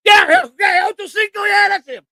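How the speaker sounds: loud, high-pitched burst of yeses; excited recognition. Real, not acted. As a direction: shout it out, thrilled to get the name. Excitedly recognises the forgotten name.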